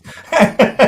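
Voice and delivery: loud, high burst of laughter; high laugh that gets the room going; infectious laugh. Real, not acted. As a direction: let out your infectious audience laugh. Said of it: "It's your laugh that shows".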